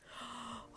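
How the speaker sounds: short, soft acted gasp; frightened gasp. A narrator's reading. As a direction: gasp as if frozen with fear. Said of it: "She froze".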